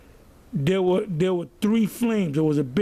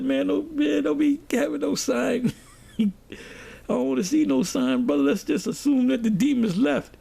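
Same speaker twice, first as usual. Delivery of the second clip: mock-nervous pleading, pitched up; 6 semitones higher than usual. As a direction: retell your own nervous protest in a higher voice. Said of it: "And I got nervous".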